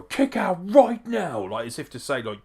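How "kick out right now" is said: high, loud, drawn-out imitated order; hammed-up angry shout, words stretched; Roman Reigns's scream. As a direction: yell it like a furious boss. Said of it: "he screams at Jey Uso".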